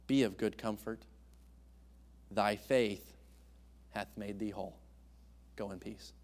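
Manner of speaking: soft and low, fading gently; tender. Retold or imitated. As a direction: comfort her gently, letting each phrase die away. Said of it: "Do you hear the tenderness".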